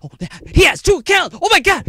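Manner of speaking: high, loud, fast mocking voice; mocking accuser. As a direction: squeal it like an outraged tattletale.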